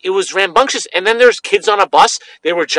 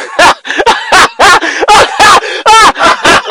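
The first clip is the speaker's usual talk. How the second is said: high, shrieking laugh; real cackling fit, high-pitched; loud helpless laughter; 13 semitones higher, 7 dB louder than usual. Real, not acted. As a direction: burst out in long, loud, uncontrollable laughter. Cracks up at gross letter.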